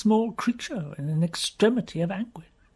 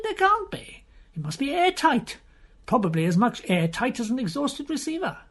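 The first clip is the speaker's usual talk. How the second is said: higher, brushing the idea aside; a higher friend waving off the notion; lightly higher voice doubting anything lives inside; Pew's voice; 8 semitones higher than usual. Acted. Pew dismisses a living thing.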